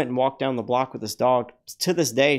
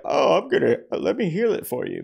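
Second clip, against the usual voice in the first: mock grand healer; 6 semitones higher than usual. Healer he refuses to be, offering a cure, mock grandiose. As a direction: parody a pompous faith healer. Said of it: "I'm not gonna sit there and be like".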